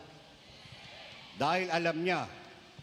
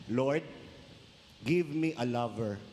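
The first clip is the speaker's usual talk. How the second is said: mock prayer; 5 semitones lower, slower than usual. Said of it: "ganito magdasal yan".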